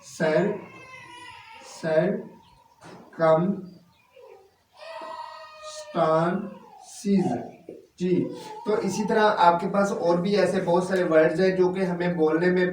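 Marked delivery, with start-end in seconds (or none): 1.7-7.3 सर्कमस्टांसेस said very slowly, syllable by syllable; teacher modelling the whole word; slow careful pronunciation. Shown as a demonstration. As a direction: stretch out सर्कमस्टांसेस for learners. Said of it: "is equal to होगा सर्कमस्टांसेस".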